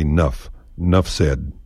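"Nuff" clipped, no e at the front; nuff for enough. Shown as a demonstration. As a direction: bite it off: "Nuff. Nuff said.". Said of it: "ditch the E in enough".

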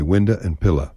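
window said as winda, pillow as pilla; Texan winda and pilla. Shown as a demonstration. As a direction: end window and pillow on an uh sound. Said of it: "take the final W off".

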